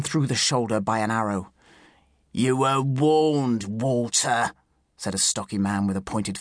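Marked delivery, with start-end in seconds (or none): 2.3-4.5 drawn-out, slightly raised threat; slow, gloating warning, leaning on WARNED; menacing warning. A narrator's reading. Sheriff's man to wounded poacher, menacing.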